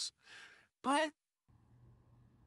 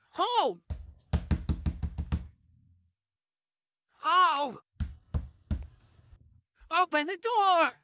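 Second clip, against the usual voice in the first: yelling; 9 semitones higher than usual. Acted. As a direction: shout at the locked door. Yelling at the door.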